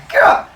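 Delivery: loud frustrated gah while throwing a chair; mock furious shout; angry yell. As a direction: bark out pure rage. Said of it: "He's like".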